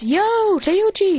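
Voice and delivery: cheery loud hello; calling out. Acted. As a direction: hail the shop owner across the counter. Calling to ramen shop owner.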